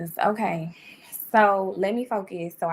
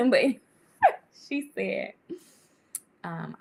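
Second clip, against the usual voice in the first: usual speaking voice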